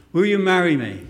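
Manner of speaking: the proposal repeated loud and clear. Shown as a demonstration. Preacher repeats the proposal loudly so the tape catches it. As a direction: boom it out for the tape. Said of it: "For the sake of the tape".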